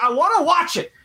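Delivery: high, pleading mock wife voice; mock whiny wife. Husband imitating his wife wanting the handshakes, high whiny. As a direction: imitate the wife's complaint. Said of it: "she's like".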